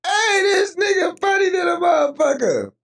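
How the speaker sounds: very high, squealed amusement; crying with laughter. Real, not acted. Streamer reacting to a funny comment, high tearful squeal. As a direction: wail it out, helpless with laughter. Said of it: "crying and laughing at the same time".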